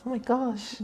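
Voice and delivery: high mock fangirl squeal; mock fangirl gasp. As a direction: squeal it like an excited fan. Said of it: "Now everyone does".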